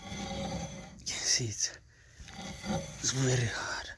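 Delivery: breathy whisper under effort; straining whisper. Real, not acted. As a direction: whisper it while pulling hard. Straining on stuck wheel.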